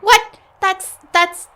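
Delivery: high, loud startled yelp; shocked outburst. Acted. Accused suspect to interrogator, startled yelp. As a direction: jolt with alarm.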